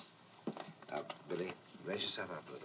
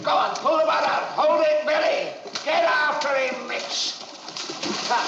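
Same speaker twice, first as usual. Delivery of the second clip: high, loud urging; urgent yelling over the action; shouted directions; 10 semitones higher, 7 dB louder, slower than usual. Real, not acted. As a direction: drive the actors on at full volume. Director shouts action during take.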